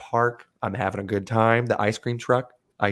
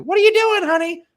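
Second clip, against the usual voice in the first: much higher, louder mock wife; 8 dB louder than usual. Teller voicing the wife who walks in, mock high squeak. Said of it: "his wife walks in".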